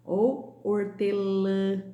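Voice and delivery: hortelã said slowly, final ã nasal and long; slow teacher demo of hortelã; nasal vowel demo. Shown as a demonstration. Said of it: "som nasal".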